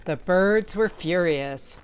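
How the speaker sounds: usual speaking voice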